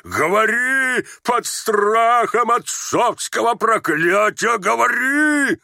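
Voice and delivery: high, slow bellowed curse; frenzied roaring. Acted. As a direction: roar it as a father threatening his curse. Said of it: "ревел генерал". (исступлённый рёв с угрозой)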